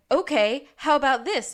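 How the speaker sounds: a loud, eager retelling of the detective; eager mock pitch. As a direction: pitch it like a big reveal. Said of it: "Lassie's like".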